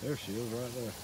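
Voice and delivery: ordinary talk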